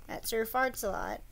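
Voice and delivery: ordinary delivery, nothing marked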